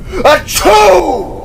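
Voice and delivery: huge high acted sneeze; loud sneeze. Cot sneezes loudly and startles the group. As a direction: let the sneeze explode. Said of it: "sneezed out the loudest of sneezes".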